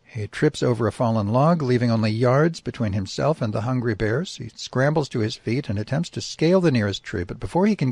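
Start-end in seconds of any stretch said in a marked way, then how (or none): none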